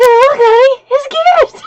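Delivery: high, loud laugh-through shout; laughing exclaim. Retold or imitated. Showing husband on video chat, high laughing shout. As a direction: burst out laughing as you show him. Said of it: "I said".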